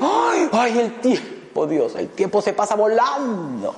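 high, wailing complaint about time flying; mock lament. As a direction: sigh it out like a fretting person, slow. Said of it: "Y dice". (lamento exagerado y agudo)

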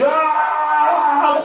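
long high-pitched scream; liberated yell. Shown as a demonstration. Runner finally breaking free, liberated yell. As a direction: let out a loud, drawn-out yell. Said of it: "solo me faltó gritar".

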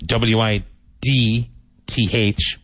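usual speaking voice

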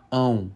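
ão fully nasal, lips closing at the end. Shown as a demonstration. Teacher showing Spanish-speaking students the nasal sound, nasal ão. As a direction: hum "Ão" through the nose with mouth closing. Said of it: "ão, nasal".

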